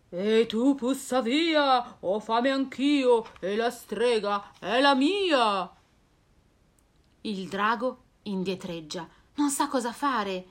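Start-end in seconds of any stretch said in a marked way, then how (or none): 0.1-5.7 lento e grugnito; mostro che grugnisce. Acted. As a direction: scaccia il drago con voce da bestione. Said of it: "Il mostro grugnisce". (il mostro scaccia il drago, lento)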